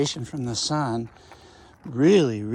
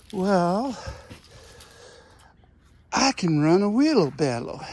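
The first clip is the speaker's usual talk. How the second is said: lightly voiced proud boy, a bit louder; imitating Rodney; 6 semitones higher, 3 dB louder, slower than usual. Boy boasting to the gardener, proud and higher. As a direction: say it like a kid's big boast. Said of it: "That's how he talked".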